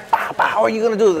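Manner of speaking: high, fast scolding in mom's voice; mom yelling. Retold or imitated. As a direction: act out a mother laying into dad. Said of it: "she starts yelling at him".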